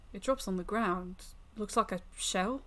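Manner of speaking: plain reading voice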